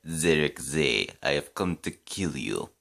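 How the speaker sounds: put-on Tim Curry's Russian accent. Reviewer imitating a film actor's Russian character, mock Russian accent. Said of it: "he, he doing a Russian accent".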